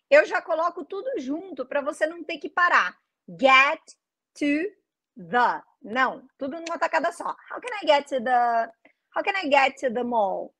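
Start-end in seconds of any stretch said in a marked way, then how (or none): none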